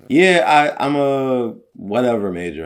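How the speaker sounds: high, loud student quote; mock student voice. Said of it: "they'd be like".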